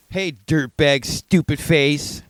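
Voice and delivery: high, slow mock-angry taunt; imitating angry kid. Little kid insulting a crow, mock angry. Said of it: "that was the inflection he used. He was angry".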